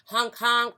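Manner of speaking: playful traffic honks; car horn honks. Acted. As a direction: imitate passing car horns. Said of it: "kept speeding by and honking".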